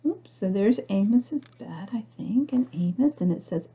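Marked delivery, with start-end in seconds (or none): none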